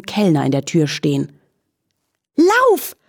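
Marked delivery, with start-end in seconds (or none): 2.4-3.0 very high yelled warning; panicked whinny. A narrator's reading. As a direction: cry out to flee. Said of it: "wieherte sie". (panisch, sehr hoch geschrien)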